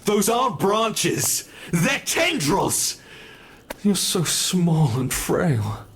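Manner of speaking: high, loud and drawn out; slow, thrilled exclamation as the vines strike; excited alarm. Acted. Monster crying out to the captive about grasping tendrils, high and slow.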